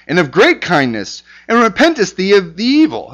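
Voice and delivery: high, loud proclaiming of the verse; scripture reading. Acted. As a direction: lift the verse up like a sermon. Reads scripture aloud.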